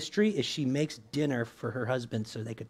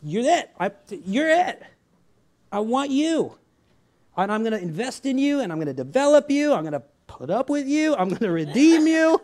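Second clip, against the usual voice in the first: a hint of an eager, adoring Jesus, higher and louder; Jesus speaking; 8 semitones higher, 5 dB louder than usual. Preacher voices Jesus choosing his beloved, higher and louder. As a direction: voice Jesus declaring his choice with fervour. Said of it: "he's like".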